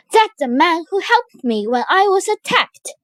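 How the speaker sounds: urgent, high-pitched outcry; excited cry. A narrator's reading. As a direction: cry out in sudden recognition. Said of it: "cried Viola".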